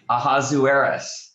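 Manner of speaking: "Ahasuerus" with oo-AIR sounded out; Ahasuerus as a-HAZ-oo-AIR-us. Shown as a demonstration. Teacher repeating the second way, Ahasuerus as a-HAZ-oo-AIR-us. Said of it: "one of two ways we pronounce it".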